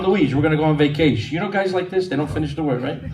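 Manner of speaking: ordinary delivery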